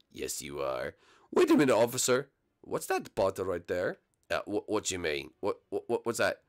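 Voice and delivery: a hint of a suspicious policeman; lightly voiced officer. Officer voice questioning.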